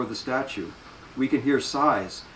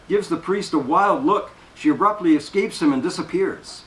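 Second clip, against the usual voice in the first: ordinary delivery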